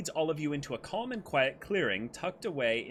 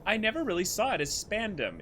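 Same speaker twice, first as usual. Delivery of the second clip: ordinary delivery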